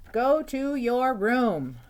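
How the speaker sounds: high, loud, slow motherly order; stern mom command. Acted. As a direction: order him slowly like a cross mom. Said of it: "David's mom always said".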